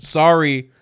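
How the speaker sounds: mock apology. Real, not acted. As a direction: say Sorry like you don't mean it. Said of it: "Sorry, not sorry".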